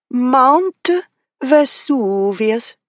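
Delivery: put-on high, drawn-out Italian accent; odd accent. A narrator's reading. Miss Flower reading from the catalogue, hammed-up Italian accent. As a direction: stretch it out in a showy Italian accent. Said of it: "in an odd accent".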